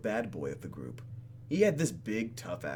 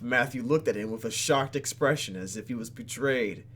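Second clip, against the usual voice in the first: ordinary talk, nothing marked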